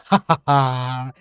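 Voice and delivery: slow, dazed "Ha, ha, ha" of delight; starstruck laugh. Retold or imitated. Tourist hearing local accents, dazed delighted laugh. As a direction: gape and laugh like a smitten tourist. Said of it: "mouth hanging open with a huge smile".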